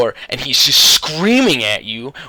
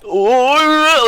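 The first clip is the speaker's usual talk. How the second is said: long, high wailing "oh"; mock scream; 13 semitones higher, 6 dB louder than usual. Showing how dad did not react, hammed-up high scream. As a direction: exaggerate a loud, drawn-out scream of fright. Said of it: "like, screaming".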